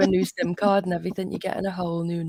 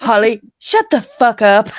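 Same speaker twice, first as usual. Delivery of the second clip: loud, higher mock telling-off; playful shouted rebuke; 4 semitones higher, 12 dB louder than usual. Teasing friend Holly in a group chat, playful shout. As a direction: yell it in jest, on the edge of laughing. Playful outburst, then laughing apology.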